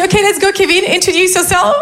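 sing-song classroom command; mock cheerful English teacher; bossy teacher voice. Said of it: "Et là, je leur dis".

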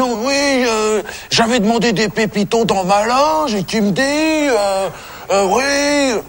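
high, drawn-out complaining mimicry; mock telling-off. Caretaker mimics the singer demanding biscuits in his dressing room, mock telling-off. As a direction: imitate a high-pitched, demanding whinge. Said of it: "il commence à m'engueuler".

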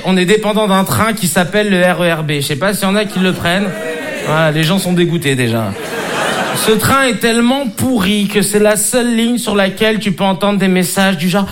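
usual speaking voice

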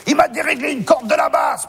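high, outraged yell; acted furious bassist. Bassist to the orchestra conductor, high furious complaint. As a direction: shout the grievance high, in comic fury.

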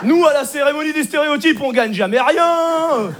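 drawn-out, aggrieved moan; mock indignant complaint. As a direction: whine like a sore loser at the awards. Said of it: "ça gueule, hein, les Blancs, ils sont pas contents".

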